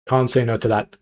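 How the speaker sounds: usual speaking voice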